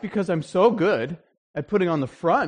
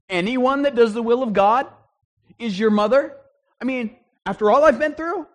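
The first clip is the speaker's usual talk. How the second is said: higher and louder, stung disbelief; incredulous mother; 7 semitones higher, 6 dB louder than usual. Retold or imitated. Mary stung by her son's words, incredulous. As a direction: play a mom who can't believe her ears.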